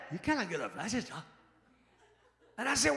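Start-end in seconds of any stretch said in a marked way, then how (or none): 0.1-1.2 exact bartender imitation. Shown as a demonstration. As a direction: say it the lazy bartender way. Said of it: "that's exactly how it said".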